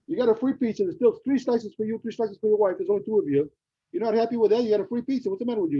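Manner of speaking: mock scolding a friend. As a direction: chide him playfully. Scolding neighbor over pizza.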